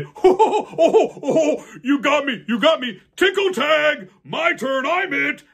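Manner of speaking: high, loud, laughing giant. Acted. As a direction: giggle like a tickled giant. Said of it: "they heard him say".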